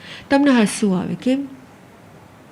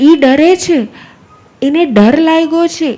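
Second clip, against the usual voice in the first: emphatic loud; 4 semitones higher, 6 dB louder than usual. Acted. Stressing the animal's fright.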